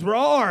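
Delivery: loud, high mock roar; sudden comic bellow; imitated roar. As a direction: roar like someone jolted awake. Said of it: "the guy jumps up and goes".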